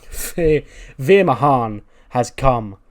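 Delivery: grand arrival announcement. Acted. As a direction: herald the wrestler's arrival with big volume. Voicing the wrestler's grand arrival announcement.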